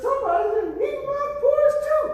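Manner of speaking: shrill-free high mother bear, hammed up; preacher voicing Mama Bear for the youth. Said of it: "Mama Bear says".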